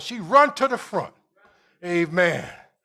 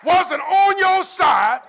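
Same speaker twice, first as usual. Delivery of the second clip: loud, high, drawn-out preacher call; rising hall-filling sermon cry; preaching at full force; 6 semitones higher, 3 dB louder, slower than usual. Acted.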